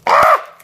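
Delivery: short loud scream; sudden yelp. Real, not acted. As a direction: let out a quick, sharp yelp. Sudden yelp between cards.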